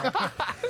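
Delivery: bright burst of laughter; high giggle. Real, not acted. Laughing at the story.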